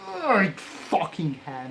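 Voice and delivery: ordinary delivery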